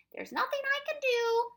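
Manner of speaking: high, loud Proc voice; firm refusal. A narrator's reading. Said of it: "said the Proc firmly".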